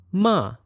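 MA starts high and falls sharply; falling tone. Shown as a demonstration. Teacher demonstrates Chinese sounds, falling tone. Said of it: "Now the Chinese sounds".

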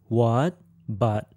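WHAT rises, BUT stays low; rising, then low. Shown as a demonstration. Teacher demonstrates English sounds, rising then low. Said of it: "if I use the English sounds".

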